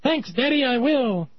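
put-on high-pitched demon child; squeaky cartoon demon kid; little demon. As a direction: answer as an eager little imp. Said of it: "so little demons can barbecue him".

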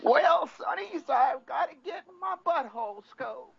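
put-on high, shouting cranky old codger; cranky old man voice. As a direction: crank it up like a grumpy grandpa. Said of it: "you have to use your cranky old man voice".

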